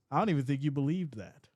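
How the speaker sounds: ordinary talk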